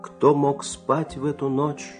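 ordinary delivery, nothing marked

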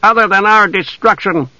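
high, hostile question; demanding. A narrator's reading. Elf warrior accuses the knight, high and demanding. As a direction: press the accusation. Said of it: "one of the elves demanded".

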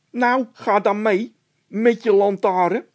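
high, loud panicky voice; frightened Bol. A narrator's reading. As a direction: panic about the noise. (Bol in paniek, hoog en luid)

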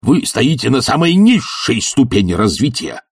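high-pitched angry shout; shouting them down. Acted. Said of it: "перекричал Филипп Филиппович".

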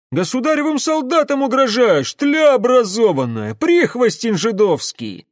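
high, loud abusive outburst; indignant rant. A narrator's reading. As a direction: soldier hurling insults in outrage. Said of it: "возмутился один из улан". (возмущённая крикливая брань)

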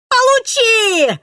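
high, loud battle yell. A narrator's reading. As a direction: scream it out as you strike. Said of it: "заорали у него за спиной".

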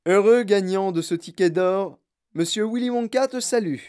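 high, grand announcement; proclaimed invitation. A narrator's reading. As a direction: read it out like a herald. Father proclaims the written invitation.

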